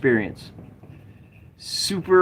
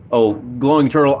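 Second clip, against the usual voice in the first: ordinary delivery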